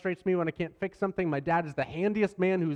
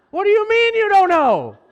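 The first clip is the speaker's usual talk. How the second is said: much higher, louder exasperated demand; a fed-up parent who can't believe the answer; exasperated dad; 12 dB louder than usual. Retold or imitated. Dad to daughter over the broken drawer, high exasperated outburst.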